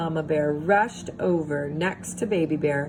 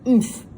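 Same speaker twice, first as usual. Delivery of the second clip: oomph of sinking; 5 semitones higher, 7 dB louder than usual. A narrator's reading. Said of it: "said Goldilocks".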